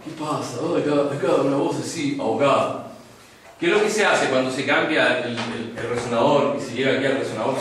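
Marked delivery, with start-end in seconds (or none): 0.1-2.9 lightly choked don; muffled godfather rasp. Shown as a demonstration. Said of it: "hablar como el padrino".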